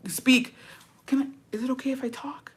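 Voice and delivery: small, high, soft pretend voice; hammed-up submissive little voice; mock meek victim. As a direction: play timid, asking permission to talk. Playing the victim card in therapy.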